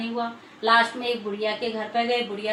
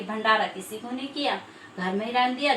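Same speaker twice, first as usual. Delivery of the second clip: ordinary delivery, nothing marked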